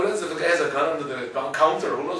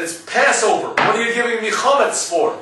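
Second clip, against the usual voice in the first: indignant outburst; 5 semitones higher, 5 dB louder than usual. Retold or imitated. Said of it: "starts pounding on the table".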